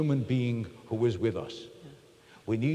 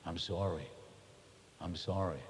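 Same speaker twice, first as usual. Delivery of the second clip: soft apology; 7 semitones lower, 9 dB quieter than usual. Acted. Voicing an apology to wife.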